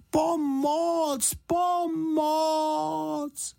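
very high, long-held cries for help; loud call for help. A narrator's reading. Bear stuck in the bath calling properly for help, loud high cry. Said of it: "potom pořádně nahlas".

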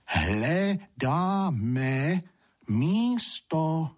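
high, syllable by syllable; careful enunciation. A narrator's reading. Two sprites to a deaf old one, enunciating carefully. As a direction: spell it out for a lip-reader. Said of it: "pečlivě vyslovovali".

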